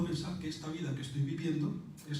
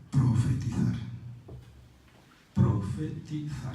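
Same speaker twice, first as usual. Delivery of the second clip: "Profetizar" muy despacio, sílaba a sílaba; enfático y lento; 2 semitones lower, slower than usual. Shown as a demonstration. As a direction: remarca profetizar con calma. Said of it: "repite despacio". (profetizar dicho lentísimo)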